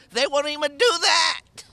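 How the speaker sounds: they won't even do that, said with rising anger; angry complaint. Real, not acted. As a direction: push the complaint harder. Tenant repeats complaint, rising anger.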